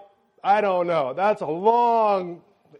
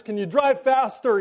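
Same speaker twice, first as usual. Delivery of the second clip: urgent, higher plea to the conductor; panicked asking; 6 semitones higher, 4 dB louder than usual. Retold or imitated. Father begging the conductor to hurry, panicked. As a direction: press the conductor, rushed and anxious. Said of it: "asking conductors".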